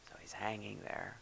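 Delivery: breathy hush, barely voiced; whisper. Real, not acted. Preacher to congregation, about the cross, whispered. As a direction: let the words fall to a hush. Dramatic hush in crucifixion account.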